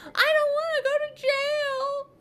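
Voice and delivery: very high, loud, slow wail; boy crying to teacher; wailing whine. A narrator's reading. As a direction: plead in tears. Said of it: "I whined".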